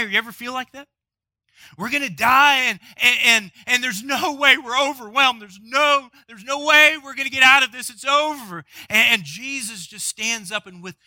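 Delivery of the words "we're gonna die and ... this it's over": high, loud overwhelmed pleading; despairing. Pretend. Preacher voicing hearers' fear, loud and desperate. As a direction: build the sense of doom.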